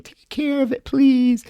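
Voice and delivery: high pleading little voice; high girly voice begging someone to look after her cat; mock plea from the girl.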